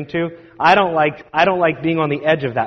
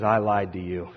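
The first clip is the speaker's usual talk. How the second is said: low confession; 9 semitones lower, 6 dB quieter than usual. Retold or imitated.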